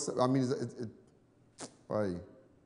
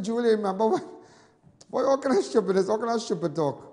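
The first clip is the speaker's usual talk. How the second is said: laughing; 8 semitones higher, 6 dB louder than usual. Retold or imitated. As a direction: voice high and loud through laughter. Teasing old friend, laughing.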